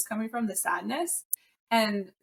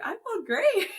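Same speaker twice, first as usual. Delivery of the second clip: high, laughing chirp; 11 semitones higher than usual. Retold or imitated. Telling her husband next day, high and laughing. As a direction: beam out a laughing reply.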